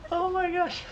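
high-pitched giggling words; laughing hard. Real, not acted. Laughing at the attack.

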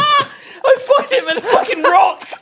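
high shout through laughter; laughing shout. Real, not acted. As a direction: yell it while cracking up. Finds friend in an odd place.

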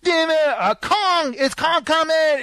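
put-on high, excited kid's shout; a small boy yelling at the screen. Imitating the little kid at Skull Island, high excited shout.

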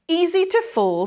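high, knowing spider voice; lightly voiced wise spider; Charlotte's voice. A narrator's reading. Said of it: "said Charlotte".

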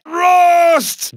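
yelling a name. Acted. Game heister yelling a teammate's name.

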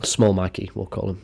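ordinary delivery

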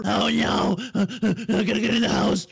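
fast, nervous rush; scared. Acted. Dad rushing home from the police, scared and fast. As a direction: panic to get inside. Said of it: "Why do you sound so scared?".

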